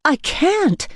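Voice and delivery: high, panicky refusal. A narrator's reading. Frightened woman refuses to cut him, panicky. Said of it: "I said, panicky".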